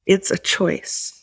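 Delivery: slow and quiet, leaning on "choice"; a slow, hushed "It's a choice" with the weight on "choice"; emphasis on choice. Shown as a demonstration. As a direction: let "choice" land slowly and softly. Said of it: "and I emphasize the word choice".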